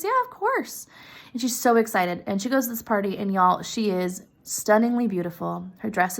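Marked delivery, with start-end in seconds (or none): 0.0-0.9 much higher, light and easy; lightly voiced generous friend; cheerful friend. Friend agreeing to lend the necklace, high and light.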